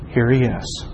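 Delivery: normal talking voice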